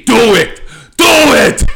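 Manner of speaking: loud, high yell at the screen; screaming at the TV. Retold or imitated. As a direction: holler it like an impatient viewer, voice shooting up. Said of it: "screaming at the T V".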